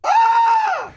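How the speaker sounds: high, loud cackle; shrieking laugh. Real, not acted. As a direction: break into a shrill laugh. Laughing at the shout.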